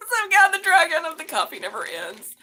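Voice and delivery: high, loud laughing exclamation; mock-epic cry through laughter; dramatic laughing lament. Real, not acted.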